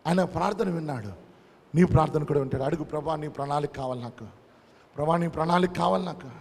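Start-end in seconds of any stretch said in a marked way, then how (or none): 2.6-4.3 hushed prayer. Shown as a demonstration. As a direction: drop to a soft, prayerful hush on ప్రణాళిక. Quiet prayer, then repeated louder.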